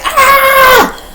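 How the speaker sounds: high sharp yelp of pain; sudden cry of pain from mace in the eyes; pained scream. Retold or imitated. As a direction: yelp like the mace just hit.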